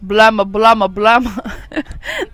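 loud singsong gibberish, like a sim; burbling nonsense in a cartoon voice; mock Simlish babble. Imitating the game's sims babbling. Mimicking gibberish game speech.

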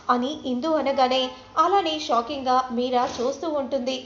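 usual speaking voice

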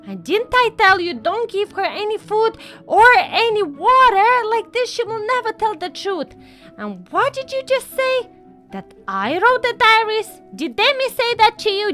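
rising outrage at being accused; angry scolding. Acted. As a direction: scold a child who disobeyed you. Mother scolding child for disobeying.

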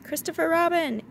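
high, loud call. A narrator's reading. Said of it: "he called down to you again".

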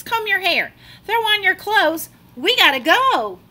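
high, loud frantic hurry; panicked rush. Acted. Child panicking and rushing family out the door. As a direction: yell out in a late-for-school panic.

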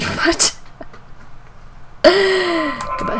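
high, falling goodbye call; drawn-out farewell. Pretend. Mock farewell in game.

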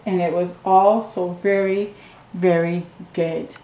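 ordinary delivery, nothing marked

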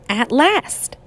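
ordinary delivery

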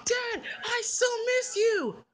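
put-on high-pitched gushing child; very high, overjoyed voice; gushing reunion. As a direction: cry out to a long-missed dad. Child greets long-missed dad.

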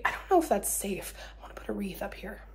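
soft whisper to herself. Real, not acted. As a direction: whisper it to yourself. Quiet aside.

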